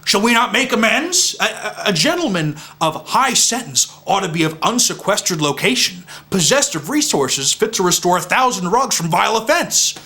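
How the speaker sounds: high, insistent theatrical harangue; heated rant. Acted. Knight urging his friend to seek amends for the rug, heated.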